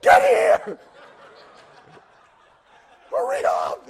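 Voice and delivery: high, loud laughing yell of impatience; laughing mock shout, high and loud; impatient shout. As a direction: hurry the slow old man along. Impatient young pastor waiting.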